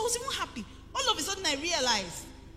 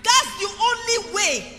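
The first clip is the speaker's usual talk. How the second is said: preaching shout; 7 semitones higher, 10 dB louder, slower than usual. Acted. Preaching emphasis.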